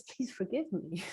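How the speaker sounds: hushed heartfelt apology; soft plea. Retold or imitated. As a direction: ask humbly, almost murmured.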